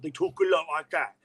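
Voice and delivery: marble-mouthed Salisbury accent. Shown as a demonstration. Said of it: "sounds like there's marbles in the mouth".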